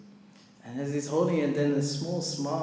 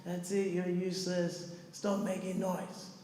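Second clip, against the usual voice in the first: put-on homeless drunk guy; 5 semitones higher, 4 dB quieter than usual. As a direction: imitate a drunk telling people off. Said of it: "he was swearing at us".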